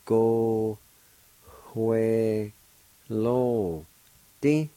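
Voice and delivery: Go, hue, lo, di each separated, lifting on di; syllable by syllable. Shown as a demonstration. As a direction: break Go, hue, lo, di into clear syllables. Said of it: "First part is pronounced like this".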